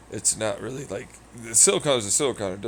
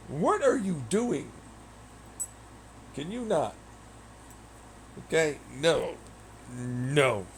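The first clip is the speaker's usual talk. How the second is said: scolding pet; 4 semitones higher, 4 dB quieter than usual. Real, not acted. Scolding the cat.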